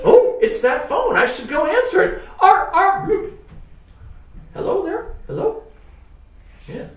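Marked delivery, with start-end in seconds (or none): 0.1-5.6 high startled voice. Acted. Monster answers phone.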